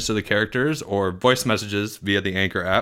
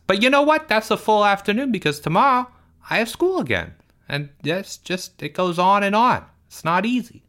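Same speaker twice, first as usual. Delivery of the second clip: put-on whiny, higher kid voice with big complaints; Porge; 9 semitones higher than usual. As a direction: play Porge, indignant and put-upon.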